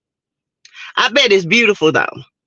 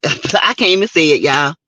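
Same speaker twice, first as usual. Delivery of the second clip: ordinary delivery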